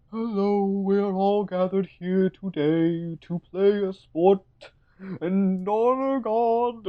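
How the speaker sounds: put-on very high, slow ceremonial old-leader voice; Maester Mika. Aged pope opening the games, high slow intoning. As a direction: intone a drawn-out, high-pitched mock blessing. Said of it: "Mika is like".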